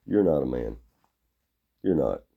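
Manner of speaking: ordinary talk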